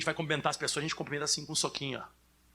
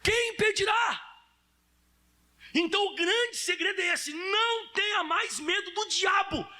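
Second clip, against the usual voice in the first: preaching shout; 13 semitones higher, 3 dB louder, slower than usual. Real, not acted. Preacher proclaiming to congregation.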